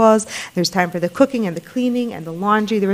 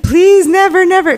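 much higher, louder, embarrassed begging; mother pleading with her children not to write a book, embarrassed and much higher; embarrassed plea; 10 semitones higher, 7 dB louder than usual. Pretend. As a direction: beg them not to, flustered. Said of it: "she would just be so embarrassed".

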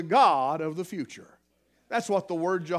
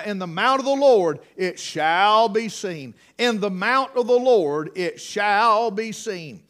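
scripture reading; 6 dB louder than usual. Acted. Reads scripture aloud with force.

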